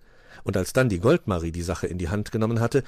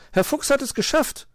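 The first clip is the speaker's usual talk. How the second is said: very high, delighted announcement; excited calling; 4 dB louder than usual. A narrator's reading. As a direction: cry out the good news. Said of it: "rief Mauz".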